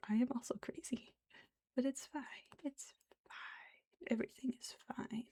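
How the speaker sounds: breathy whispered joke. Real, not acted. Whispering to the viewers that she is also crazy. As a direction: whisper it like a secret.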